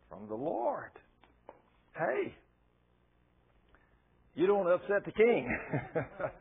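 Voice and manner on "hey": normal talking voice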